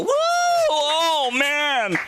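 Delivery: high, drawn-out whoop of the crowd; hammed-up cheering; mock crowd cheer. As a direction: imitate an audience whooping in support. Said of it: "you'd all go".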